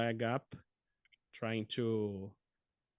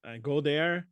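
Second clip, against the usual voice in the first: ordinary delivery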